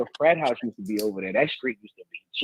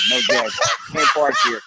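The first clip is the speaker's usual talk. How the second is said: mock teasing. Teasing rival teachers at school.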